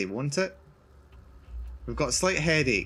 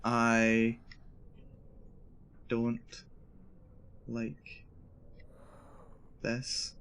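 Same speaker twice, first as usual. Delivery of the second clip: each word drawn out and much softer, fading; slow dread; 3 semitones lower, 8 dB quieter, much slower than usual. Real, not acted. Scared player to the dark room, slow and fading. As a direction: let each word drop away into uneasy quiet. Words spaced out, fading quiet.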